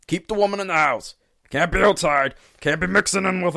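hammed-up country bumpkin laying down the law. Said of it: "that was the redneck voice".

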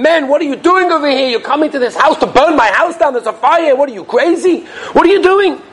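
high-pitched, agitated imitation of a father's protest; alarmed scolding. Father tells guests they'll burn his house down, mock alarmed scolding. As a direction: retell it as an incredulous telling-off.

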